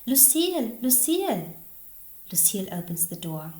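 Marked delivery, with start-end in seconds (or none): 0.1-1.5 loud, high call of a name. A narrator's reading. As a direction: call at the house for someone. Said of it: "stops at a house and shouts".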